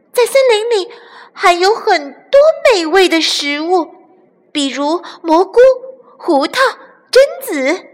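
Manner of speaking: put-on very high, childlike voice; high, bright-eyed young pleading; eager little chick. A narrator's reading. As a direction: tempt them with treats, item by item.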